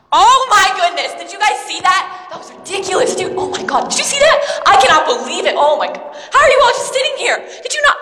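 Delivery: breathless high disbelief; bursting to tell what just happened; wide-eyed amazement, pitch jumping high; excited. Acted. Excited retelling of Palm Sunday.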